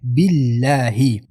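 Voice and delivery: slow 'بالله' with distinct bi and hi; clear kasra. Shown as a demonstration. Teacher shows the clear h, slowly pronounced. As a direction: stretch 'بالله', sounding the final hi.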